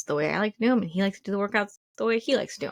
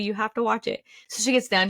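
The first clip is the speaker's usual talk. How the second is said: normal talking voice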